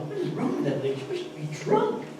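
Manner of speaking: lightly voiced priest wondering aloud; puzzled priest. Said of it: "priest is going".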